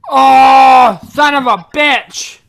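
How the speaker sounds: loud, drawn-out cursing; exasperated outburst. Real, not acted. Host cursing as the wrong guest turns up, exasperated shout. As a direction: shout it out in real frustration. Host realises he was tricked.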